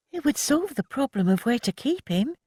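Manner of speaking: high, grudging concession; young woman admitting the point. A narrator's reading. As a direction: give in reluctantly. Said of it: "she admitted".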